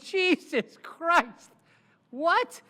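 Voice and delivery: high, loud and drawn-out Jesus Christ; a lawyer hearing his client has six million in cash; stunned disbelief. Retold or imitated. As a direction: let the shock stretch out.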